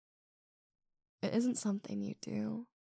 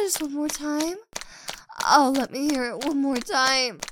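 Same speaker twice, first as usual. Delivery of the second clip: loud, aroused and insistent; heated teasing demand; 3 semitones higher, 10 dB louder than usual. Acted. Teasing a partner to say it once more, heated demand. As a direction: tease loudly, pressing for an answer.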